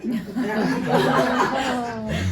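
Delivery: loud burst of laughter; real laughter. Real, not acted. Speaker joking with friends about holy-day drama, laughing loudly.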